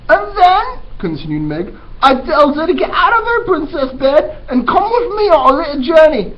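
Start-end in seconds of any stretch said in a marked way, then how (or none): 0.1-0.8 put-on high, loud, brash girl voice; eager Mig. Teacher voicing dim servant girl Mig, high and loud. 2.0-6.3 put-on high, loud, brash girl voice; eager Mig. Teacher voicing dim servant girl Mig, high and loud.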